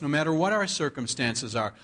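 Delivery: usual speaking voice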